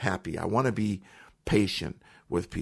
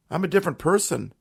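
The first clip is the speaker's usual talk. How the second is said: normal talking voice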